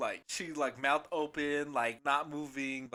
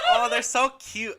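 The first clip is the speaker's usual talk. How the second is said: high, loud pretend cooing; hammed-up adoring squeal of words; mock gushing; 8 semitones higher, 12 dB louder, slower than usual. Joking about admiring the customer's arms, mock gushing.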